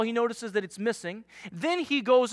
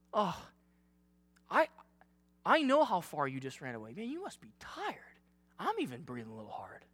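tender whisper; 4 semitones lower, 15 dB quieter than usual. Retold or imitated. Shepherd to the tired lost sheep, tender whisper. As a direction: hush like a parent to a tired child. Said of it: "you get down here and you're like".

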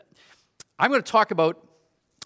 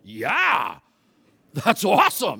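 mock glee; 6 dB louder, slower than usual. Imagined investor reacting.

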